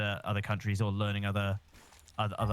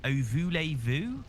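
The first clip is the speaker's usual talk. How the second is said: slow, higher-pitched Bristolian French; drawn-out French with no French accent; 5 semitones higher, slower than usual. Acted. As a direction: imitate a Bristolian reading out French. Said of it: "rather than actually putting an inclination or an accent on it".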